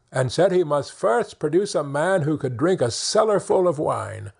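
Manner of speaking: ordinary delivery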